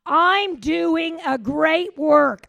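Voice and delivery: full-force preaching shout; shouted declaration. Shown as a demonstration. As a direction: belt it out to the whole church. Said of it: "Let's say it again".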